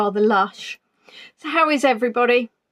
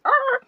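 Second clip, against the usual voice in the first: a sudden, high little cry of annoyance; mock yelp of frustration; 13 semitones higher than usual. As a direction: yelp as if you've just bumped into the furniture again. Bumping into ottoman behind her.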